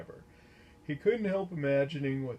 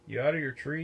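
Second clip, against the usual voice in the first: a sudden, louder startled yelp; 4 semitones higher, 6 dB louder, faster than usual. A narrator's reading. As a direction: blurt it out in alarm. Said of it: "yelped Stan".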